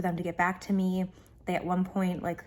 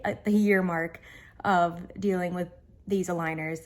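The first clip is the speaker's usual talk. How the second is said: ordinary talk, nothing marked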